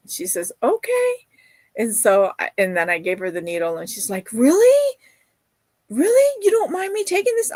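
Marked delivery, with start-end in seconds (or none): 0.6-1.1 chirpy okay. Retold or imitated. Girl accepting the answer. 4.3-7.5 much higher, louder delighted disbelief; amazed really. Retold or imitated. Girl amazed at a gift.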